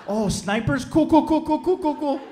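high, nervous fake-casual cool cool cool; fake calm. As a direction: pretend you're fine while panicking. Fake-calm reaction to danger.

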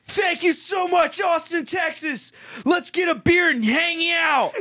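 high, slow stretched-out shout; mocking frontman shout. Friend mocking the band's closing line, slow high shout. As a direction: ham up a bandleader's sign-off. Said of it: "Why did you say it like that".

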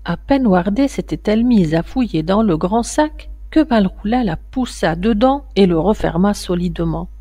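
plain reading voice

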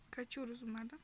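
ordinary talk

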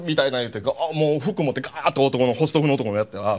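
ordinary talk

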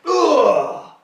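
loud, high grunt of impact; mock pained grunt. Acting out ATM fees hitting you hard, loud mock grunt. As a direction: grunt as if taking a punch.

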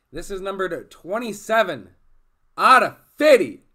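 screaming, very high and loud; hype shout. Real, not acted. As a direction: yell it like a jackpot. Big hit reveal, shouting.

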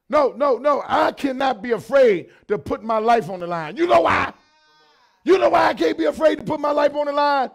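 loud, rising preacher's cadence; full-force preaching. Acted. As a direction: drive each phrase home to the congregation.

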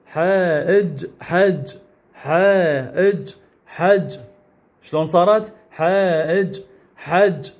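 حاء إج حج spelled out slowly, then merged into حج; slow syllable blending. Shown as a demonstration. Teacher blending letters for children, slow and careful. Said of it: "وهذا إج".

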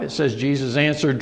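ordinary delivery

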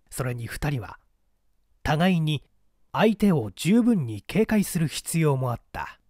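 ordinary delivery, nothing marked

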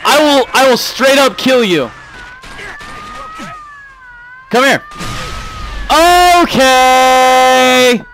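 very high, loud screamed threats; furious screaming. Real, not acted. Streamer threatening a game character, furious scream.

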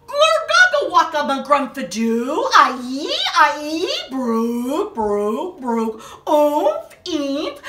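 loud goofy gibberish; silly nonsense sounds. Acted. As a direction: spit out the silly noises. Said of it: "make silly sounds like".

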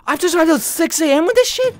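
high-pitched outburst of indignation; indignant complaint. Real, not acted. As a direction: protest at what greets you on arrival. Complains about the game.

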